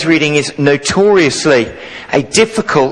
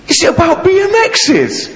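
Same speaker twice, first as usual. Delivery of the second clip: put-on very high, excited child's question; light up like a thrilled kid; excited small boy hoping; eager boy. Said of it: "his little face lit up".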